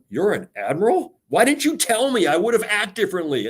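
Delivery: rising to a high, startled squeak on why didn't you tell me; surprised coworker. Retold or imitated. Coworker just learning he was an admiral, high and astonished. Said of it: "people going".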